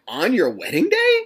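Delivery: high, loud retold disbelief; retelling his shocked reply to his lawyer.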